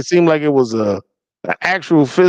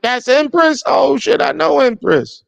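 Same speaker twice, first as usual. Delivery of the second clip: surprised recognition; 9 semitones higher than usual. Retold or imitated. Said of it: "I'm like".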